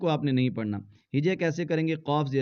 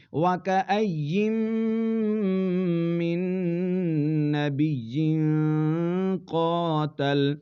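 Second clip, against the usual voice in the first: slow, drawn-out Quranic recitation with long pauses; measured tajweed reading; 2 semitones higher than usual. Shown as a demonstration. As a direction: recite each word slowly and apart. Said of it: "tajweed reading demo".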